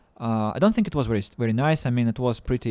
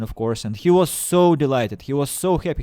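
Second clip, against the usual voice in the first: ordinary delivery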